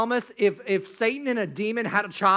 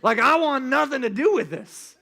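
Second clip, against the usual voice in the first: put-on kids backing away; 4 semitones higher, 6 dB louder than usual. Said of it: "we're like".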